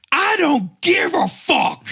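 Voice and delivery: high, drawn-out crying yell; hammed-up furious driver, stretching the words; angry mock yell.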